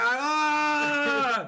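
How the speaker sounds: high drawn-out "ah" of agony; mock cry of pain. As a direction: cry out as if hit in the groin. Acting out Josh hit in balls.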